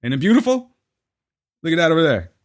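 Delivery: a hint of a cheery tour guide; lightly voiced gushing tour guide. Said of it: "all I really saw with that was".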